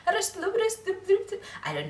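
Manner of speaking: mock Scottish accent. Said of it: "very Scottish accent going like".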